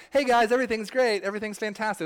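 high, loud, chirpy mock cheer; fake cheerful. Mocking Christians who pretend all is great, in fake cheer. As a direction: parody forced happiness. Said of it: "Christians should walk around being like".

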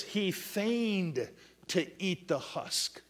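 drawn-out verse, leaning on feigned; slow emphatic reading. Acted. Preacher to congregation, slow deliberate reading. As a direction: lay out each word of the husk verse slowly.

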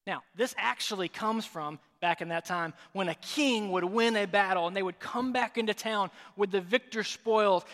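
ordinary delivery

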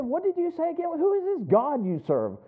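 What said high and startled, then settling; mock amazed boss. The Allstate boss, startled, asks about God in mock disbelief. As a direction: play the boss caught off guard, rising in disbelief. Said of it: "He said".

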